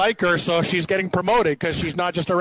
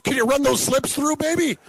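much higher put-on voice; mock sweet-talker; 9 semitones higher than usual. Imagined request to ticket-taker girlfriend at home, mock high sweet-talk. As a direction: wheedle a favor in a hammed-up high voice.

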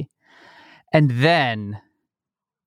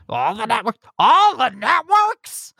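mumbled reply; 10 semitones higher than usual. Retold or imitated. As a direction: mumble it, then stretch it high. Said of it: "you just sort of hear mumble".

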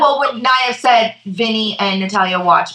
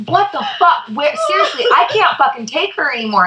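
high, incredulous imitation of brother; mock exasperation; 8 semitones higher than usual. Host imitating her exasperated brother, mock outrage. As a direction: act out being comically fed up.

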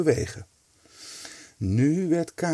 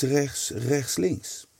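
usual speaking voice

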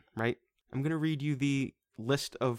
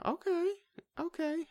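surprised okay. Real, not acted. Reacting to Clara Barton tidbit.

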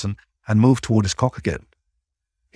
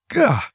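high sudden yelp of surprise; sharp yelp; 11 semitones higher than usual. Acted. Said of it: "Hudson yelped".

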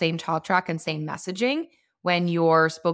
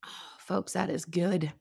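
while sipping a drink; 6 dB quieter than usual. Real, not acted. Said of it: "I'm gonna swig this high noon".

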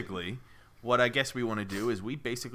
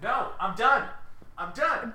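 high-pitched mock protest; mock huff; 12 semitones higher, 3 dB louder, slower than usual. Podcaster quitting after his accent is criticised, mock huff. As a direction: quit in a playful, high-pitched sulk.

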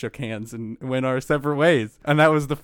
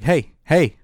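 louder, higher retold call for attention; 4 semitones higher, 8 dB louder than usual. Stranger waving at a train passenger, imitated calling out. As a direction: imitate someone calling to get noticed.

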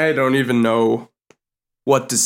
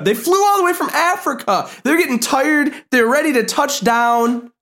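high-pitched pleading protest; mock outrage; 9 semitones higher than usual. Mock outrage over angels shot down.

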